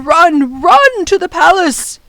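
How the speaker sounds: loud, urgent command; yelling. A narrator's reading. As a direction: send them running. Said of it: "He yelled to the children".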